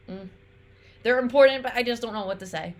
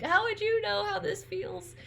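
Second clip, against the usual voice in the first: much higher, a mock wounded whine; whiny Johnny Cage; 13 semitones higher than usual. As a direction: play the hurt, whiny hero. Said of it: "And he's like".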